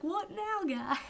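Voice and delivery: a hint of clueless Neville; lightly voiced Neville. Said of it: "Neville was like".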